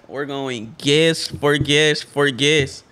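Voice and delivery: normal talking voice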